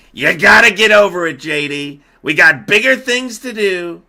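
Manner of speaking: loud, high-pitched mock scolding; Trump. President brushing off his vice president, mock shouting. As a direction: belt it out to shut down the whining. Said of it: "And Trump's like".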